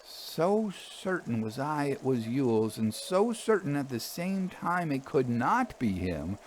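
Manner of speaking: plain reading voice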